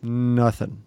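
ordinary talk, nothing marked

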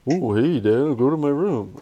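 childish mocking, pitch swooping about; light teasing parrot of an order; mocking kid. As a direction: mimic Dad in a sing-song taunt. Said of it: "He'll make fun of you".

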